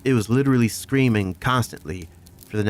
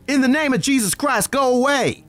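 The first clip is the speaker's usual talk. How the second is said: desperate shout; 4 dB louder than usual. Retold or imitated. Firing rifle, banishing the creature.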